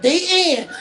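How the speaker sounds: high, loud echo of someone's "the end"; playfully exaggerated, emphatic sign-off; mimicked pronouncement. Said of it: "You said "the end," like".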